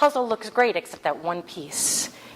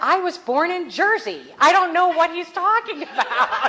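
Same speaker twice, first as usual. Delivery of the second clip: higher and louder, laughing through it; retold quote, laughing; 11 semitones higher, 5 dB louder than usual. Retelling the woman's retort while laughing. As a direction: quote her while cracking up. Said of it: "She said".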